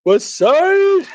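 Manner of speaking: swagger it out, then pop the last words up; mock tough guy. Bit imitating a guy showing off.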